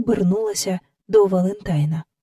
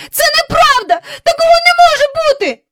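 very high, loud, hurried cry; panicked girl's outburst; shrieking denial; 6 dB louder, faster than usual. Acted. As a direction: shriek it out in disbelief. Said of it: "скрикнула Джейн".

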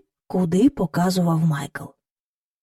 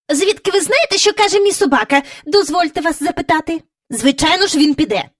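high, loud, offended lady; haughty retort; 8 semitones higher, 3 dB louder, faster than usual. A narrator's reading. Miss Lark haughtily questions Mary Poppins, high and offended. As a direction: look down on her. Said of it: "згорда подивилася на неї".

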